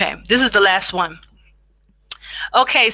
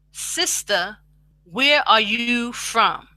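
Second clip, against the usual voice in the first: slow demo; slower than usual. Shown as a demonstration. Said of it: "You can speak it out".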